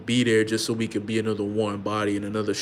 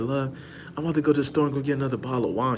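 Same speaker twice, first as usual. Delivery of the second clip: rushed eager husband; 3 semitones higher, faster than usual. Retold or imitated. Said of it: "He like".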